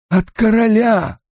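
high, drawn-out, trembling-with-awe delivery; reverent awe. A narrator's reading. Servant revealing a letter from the king, awestruck. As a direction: stretch it out slowly in awe, pitched high. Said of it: "Голос его понизился до благоговейного трепета".

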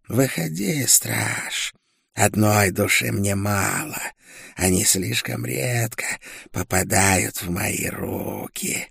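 eerie, gloating creature voice; monstrous witch. A narrator's reading. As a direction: relish the words like a hungry old witch.

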